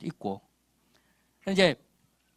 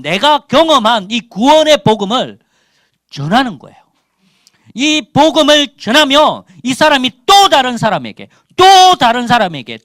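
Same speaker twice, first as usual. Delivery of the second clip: loud, high-pitched exhortation; forceful preaching; 6 semitones higher, 10 dB louder than usual. Real, not acted. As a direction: press the point on the congregation.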